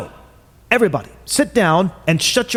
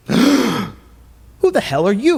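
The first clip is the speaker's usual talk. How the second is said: startled; 6 semitones higher, much slower than usual. Acted. Startled by a sudden arrival.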